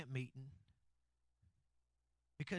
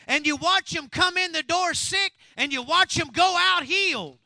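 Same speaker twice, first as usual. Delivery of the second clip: loud, driving sermon delivery; louder and louder from the pulpit; full-force preaching; 9 semitones higher, 16 dB louder than usual. Acted. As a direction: preach it hard to the congregation.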